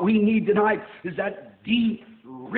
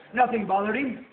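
ordinary talk, nothing marked

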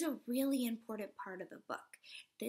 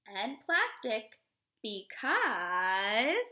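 slow, expressive storybook read-aloud; reading to children; 3 dB louder, slower than usual. Acted. Teacher reading a picture book to children, expressive read-aloud. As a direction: read it warmly to a class of little kids.

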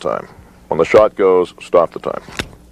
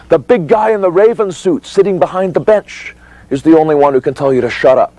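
raised, high-pitched declaration; emphatic insistence; 8 semitones higher than usual. Shown as a demonstration. Instructor on who can silence a witness, insistent. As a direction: drive it home in a high, insistent voice. Coaching witness, still worked up.

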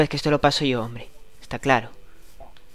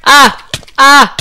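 pained yelps; 11 semitones higher, 13 dB louder than usual. Real, not acted. Burning in lava.

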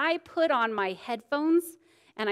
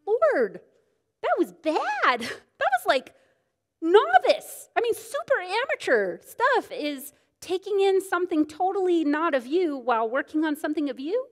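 much higher, exaggerated disapproval; mock scolding; 8 semitones higher, 4 dB louder than usual. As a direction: tease yourself as a total novice. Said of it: "constantly is telling me".

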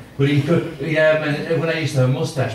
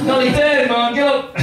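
high mock-Jamaican wake-up call; Jamaican alarm clock; 12 semitones higher, 3 dB louder, slower than usual. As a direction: rouse a sleeper with a high Jamaican cry. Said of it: "like him for an alarm clock".

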